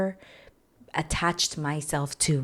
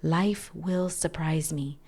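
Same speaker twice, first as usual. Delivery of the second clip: slow mantra; slower than usual. Shown as a demonstration. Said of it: "So that's the third mantra".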